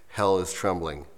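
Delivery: ordinary talk, nothing marked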